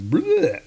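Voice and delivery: comic retching noise; mock vomiting. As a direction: imitate a giant throwing up. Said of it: "he's throwing up this".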